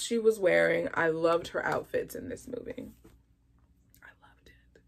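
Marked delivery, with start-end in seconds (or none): 4.0-4.8 tiny breathless whispered gush. Real, not acted. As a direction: whisper it to yourself in delight.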